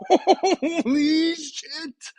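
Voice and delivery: high, very slow, loud stretched cry; drawn-out disbelief. Real, not acted. Gamer stunned by the roll, drawn-out joyful cry. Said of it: "I'm giddy right now".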